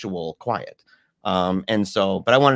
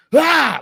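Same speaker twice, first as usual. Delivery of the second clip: high, loud scream of fright; short frightened shriek; startled scream; 14 semitones higher, 8 dB louder than usual. Retold or imitated. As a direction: yelp like you just got grabbed in the dark. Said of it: "like this".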